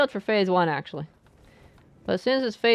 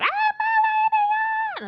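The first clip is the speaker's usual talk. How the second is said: shrill, high, slow mock cry; mock scream; 4 dB louder, much slower than usual. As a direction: ham up a screaming maid. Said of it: "she just screamed out".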